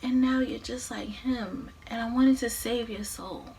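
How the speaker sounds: soft, hushed confession; heartfelt, tearful. Acted. Mother regretting her bargain to her son, soft and tearful. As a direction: let it sink to a sorrowful hush. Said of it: "she's giving him these heartfelt tears".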